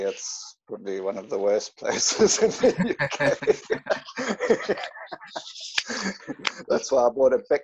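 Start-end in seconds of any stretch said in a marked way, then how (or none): 3.4-6.6 long wheezy, higher-pitched laugh. Real, not acted. Surfer laughing at his own complaint, long wheezy laugh. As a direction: laugh breathlessly at your own remark. Laughs after own joke about worst place.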